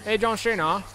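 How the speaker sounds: ordinary talk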